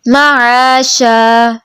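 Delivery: slow melodic tajweed chant; Quran recitation. Shown as a demonstration. Teacher modelling the verse for the student in slow chant. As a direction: recite slowly, drawing out each vowel.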